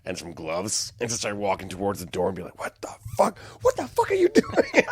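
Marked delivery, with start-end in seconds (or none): 2.6-4.8 high, breathy disbelief at himself; hammed-up baffled self-questioning; mock bewilderment. Retold self-talk on waking.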